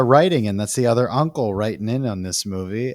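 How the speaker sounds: normal talking voice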